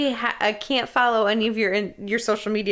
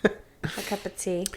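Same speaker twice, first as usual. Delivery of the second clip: mock British accent; 2 semitones lower, 7 dB quieter, slower than usual. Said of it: "in a British accent".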